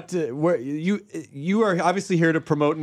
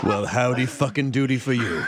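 lower gruff Swearengen; 7 semitones lower than usual. Pretend. Swearengen character replies.

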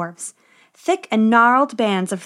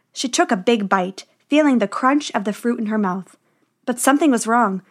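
plain reading voice

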